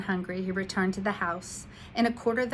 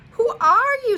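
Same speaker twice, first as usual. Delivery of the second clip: a little frightened; 13 dB louder than usual. A narrator's reading. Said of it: "a little frightened".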